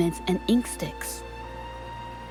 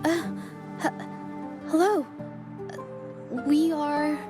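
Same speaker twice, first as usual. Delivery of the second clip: higher, halting stammer; hesitant, caught out; 5 semitones higher, 3 dB quieter than usual. Acted. Visitor asked who they are, hesitant stammer. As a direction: stumble nervously into a greeting. Caught by exiles, stumbling reply.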